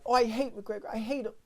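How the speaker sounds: high, sneering bartender impression; a petty bartender grumbling about a fighter; mocking bartender. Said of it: "the bartender behind the bar was like".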